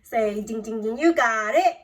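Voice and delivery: loud cheerful ding ding ding; excited ding. Real, not acted. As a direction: ring it out like a game-show bell. Teacher celebrates right answer.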